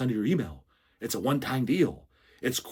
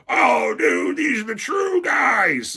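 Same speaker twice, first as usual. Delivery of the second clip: high, loud, drawn-out mock cheer; mock gushing fan; 12 semitones higher, 4 dB louder, much slower than usual. Streamer mocking what most people consider true, hammed-up fan shout. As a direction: put on an over-the-top shouting fanboy.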